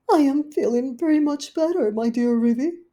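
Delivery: high, loud dismayed lady dog; fretting Duchess. Acted. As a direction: wring your paws over it. Duchess pleads to leave.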